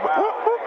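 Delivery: high whoop whoop siren; voicing the police siren pulling him over; police siren imitation. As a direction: mimic the cop car's siren.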